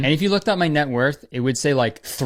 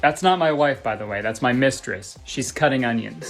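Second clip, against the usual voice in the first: ordinary delivery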